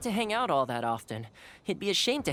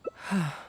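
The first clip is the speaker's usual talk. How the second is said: moved sigh. Real, not acted. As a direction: breathe out, overwhelmed by the gift. Moved by the gift.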